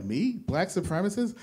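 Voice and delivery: incredulous, rising high on supremacist; mock disbelief. Answering the comic's jab with playful mock disbelief. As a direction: act shocked at the accusation. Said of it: "I'm like".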